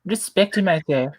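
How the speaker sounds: high, hammed-up royal voice; Queen of England. Demanding respect for him, high put-on queenly voice. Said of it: "I was doing the Queen of England".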